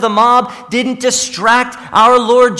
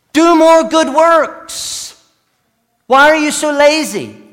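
mock scolding preacher; 8 semitones higher, 6 dB louder than usual. Preacher mocking a scold who nags the lazy, hammed-up. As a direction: nag them like a finger-wagging preacher. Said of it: "That's not the message".

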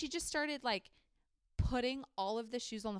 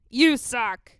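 a blast of a teasing comeback, much louder; loud playful retort; 3 semitones higher, 19 dB louder than usual. Real, not acted. Bantering with the cohost, she shouts the jab right back. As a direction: fire it straight back, loud.

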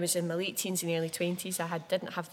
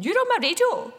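excited shout; 11 dB louder than usual. Retold or imitated. Friends texting about radio repeat.

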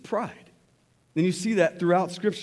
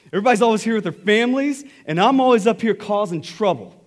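louder, fast and higher, blurting it out; loud excited confession; 6 semitones higher, 7 dB louder, faster than usual. Retold or imitated. A stranger at the Hole in the Rock blurting it out, loud and fast. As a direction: burst out the confession at full volume.

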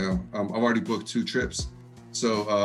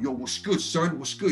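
light East Coast street greeting, higher on "son"; quick imitation of East Coast slang talk; imitated East Coast talk; 4 semitones higher than usual. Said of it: "the way they talk".

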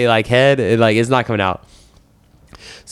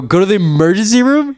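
higher-pitched disbelieving question; incredulous echo; 10 semitones higher, 4 dB louder than usual. Retold or imitated. Patient repeats the clinic kid's advice, incredulous. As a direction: throw it back in disbelief, pitch climbing.

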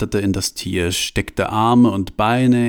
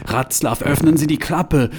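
urgent demand; 6 semitones higher than usual. Acted. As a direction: order him frantically. Franz demands the hatch open.

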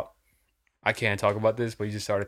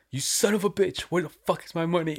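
high-pitched retold outburst; angry confrontation; 7 semitones higher than usual. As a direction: play an angry dealer demanding his money. Dealer angrily confronting Mao over money.

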